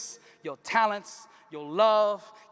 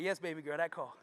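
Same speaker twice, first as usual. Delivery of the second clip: father's voice; 6 semitones lower, 8 dB quieter than usual. Acted. Father's low calm reply.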